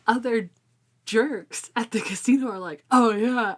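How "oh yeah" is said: louder, higher rowdy crowd; mock jerks' cheer. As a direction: cheer like a boorish gambler.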